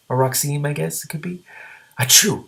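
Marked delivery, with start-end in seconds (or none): 2.0-2.5 sneeze. Acted. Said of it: "Every time the gray green, green dragon sneezed".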